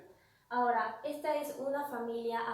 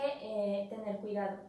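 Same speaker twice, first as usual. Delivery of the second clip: normal talking voice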